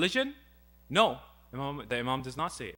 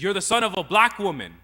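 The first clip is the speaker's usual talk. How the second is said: loud, higher mock outburst; retold angrier attack; 4 semitones higher, 8 dB louder than usual. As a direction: raise the voice in an acted insult. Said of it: "he gets even more angry".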